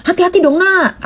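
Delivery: put-on high-pitched scolding call at a child; scolding yell. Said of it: "nggak perlu diteriakin". (teriakan menegur anak dengan nada jauh lebih tinggi)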